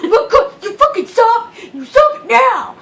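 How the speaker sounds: hammed-up telling off; mock angry shout. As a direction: scold loudly through a laugh. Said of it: "And I was like".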